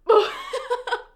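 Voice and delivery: a loud, high sob-laugh; crying laugh. Real, not acted. Reader losing it at the hero's death, crying laugh. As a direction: break into a laugh that's really crying. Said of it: "I cried the first time I read this scene".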